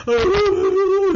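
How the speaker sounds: high wailing sob, imitated; mock sob. Son sobbing to his mother, high mock wail. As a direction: let out a high blubbering wail. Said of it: "And I'm like".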